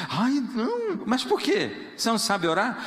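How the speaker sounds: high, whiny protest; high mock fluster; mock flustered newcomer. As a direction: squeal it out like an embarrassed newcomer. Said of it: "Aí a pessoa diz assim".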